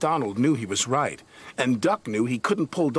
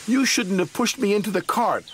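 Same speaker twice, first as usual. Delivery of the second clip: high, indignant huff; huffy; 9 semitones higher, 3 dB louder than usual. Acted. Donald huffing blame at Douglas, indignant. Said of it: "huffed Donald".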